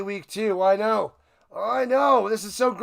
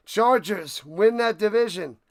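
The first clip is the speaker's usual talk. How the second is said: ordinary talk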